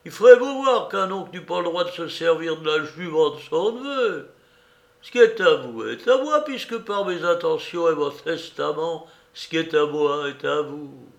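put-on high-pitched indignant old uncle; old beggar. Uncle claiming his nephew's mare, indignant high old voice. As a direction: argue loftily in a high old man's voice.

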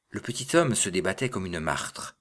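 usual speaking voice

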